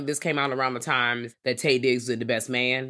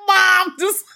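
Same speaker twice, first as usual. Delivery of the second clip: very high, loud mock whine; imitating protesting child; 10 dB louder than usual. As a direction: plead with Mom as a scared kid. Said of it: "I'm like".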